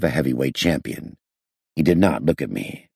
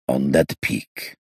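slow, low and muffled; 2 semitones lower, much slower than usual. A narrator's reading. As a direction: draw it out, smothered and low. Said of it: "in an unnaturally low and muffled voice".

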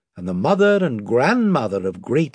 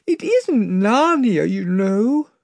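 put-on higher, tearful girl, slow; sobbing; 8 semitones higher, 3 dB louder, slower than usual. A narrator's reading. Lucy sobs to Aslan, tearful and higher. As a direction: let the sob catch in her voice. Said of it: "sobbed Lucy".

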